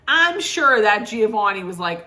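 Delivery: normal talking voice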